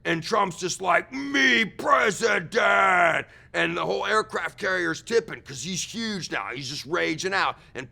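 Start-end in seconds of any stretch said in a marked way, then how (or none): 1.1-3.3 loud, slow, dragged-out shout; brutish monster voice. Retold or imitated. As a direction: boom it like a hulking brute. Monster Trump declares himself.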